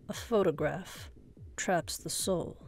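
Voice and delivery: plain reading voice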